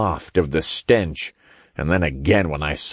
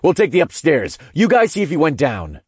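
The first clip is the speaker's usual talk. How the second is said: brisk, higher-pitched order; raised commanding voice; 8 semitones higher, faster than usual. A narrator's reading. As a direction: give quick orders to the team. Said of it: "I commanded".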